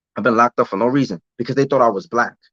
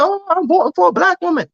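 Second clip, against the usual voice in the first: mocking squeaky high voice, much higher; mocking voters; 3 dB louder than usual. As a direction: ham up the gushing voters in a silly falsetto. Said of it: "They said".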